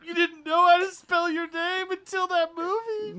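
high, slow disbelief; mock shocked, drawn out; incredulous.

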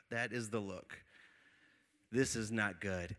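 low, hushed realisation; quiet dread. Retold or imitated. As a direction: say it under your breath, sinking. Inner dread seeing the crazy look.

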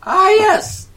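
high, loud bad Irish voice; terrible Irish accent. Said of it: "it's an Irish accent".

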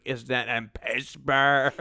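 drawn-out, slurred mock question; slow mock-groggy imitation; imitating her complaint. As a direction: drag it out like her whine. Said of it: "That's what she said".

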